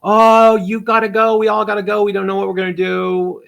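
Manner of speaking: high, loud panicky voice; mock alarm, everyone has to go; panicked bosses. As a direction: bosses in a panic telling staff to leave. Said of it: "And they're like".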